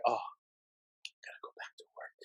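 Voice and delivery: breathy whisper of weekend ending; whispered dread. Retold or imitated. Dreading Sunday night return to work, whispered. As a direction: whisper it like a sigh before Monday.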